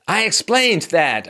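slow, high, indignant emphasis; Serena Williams berating. Retold or imitated. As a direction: stretch it out in mock exasperation. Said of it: "started to verbally abuse Ramos".

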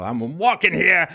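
loud, high, drawn-out movie street yell; Ratso Rizzo. Retold or imitated. Man yelling at a car in the street, loud impression. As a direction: shout it with a weird, exaggerated inflection. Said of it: "got a kind of weird vocal inflection".

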